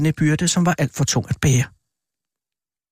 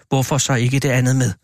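ordinary delivery, nothing marked

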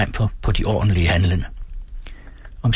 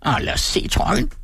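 high screech at ordinary volume; shrieking old man; 11 semitones higher than usual. A narrator's reading. Old man demands to see the jacket, shrieking. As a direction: screech it out like a greedy old ogre. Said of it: "skreg den gamle".

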